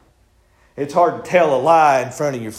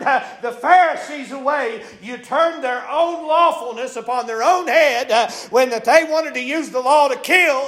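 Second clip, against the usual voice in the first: full-force preaching; 10 semitones higher than usual. Acted. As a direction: lift the church with every line.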